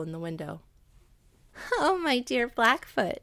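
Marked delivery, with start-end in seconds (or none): 1.8-3.2 laughingly. A narrator's reading. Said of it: "she began laughingly".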